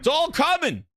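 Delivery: excited. Real, not acted. Hyped yelling.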